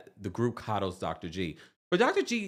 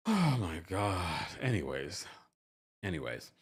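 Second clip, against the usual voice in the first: exasperated sigh; 6 semitones lower, 6 dB quieter than usual. Real, not acted. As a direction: drop it flat and move on. Exasperated wrap-up after Taurus joke.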